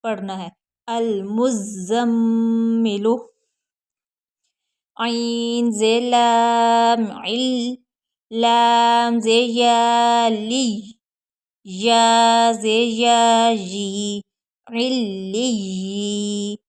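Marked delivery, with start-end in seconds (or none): 0.9-3.2 मुज़म्मिलु very slow with म्म held nasally; nasal hold. Shown as a demonstration. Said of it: "थोड़ी देर ठहर कर पढ़ना है". 5.0-16.6 very slow, loud syllable chant; very slow spelling drill. Shown as a demonstration. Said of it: "spelling drill".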